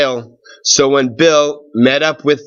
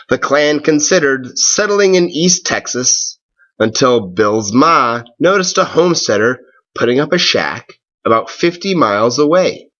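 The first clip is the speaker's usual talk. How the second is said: plain reading voice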